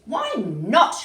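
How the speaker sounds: high, loud, swooping protest; loud, high-pitched questioning call; cried out. A narrator's reading. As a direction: cry it out in challenge. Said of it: "cried the Roos".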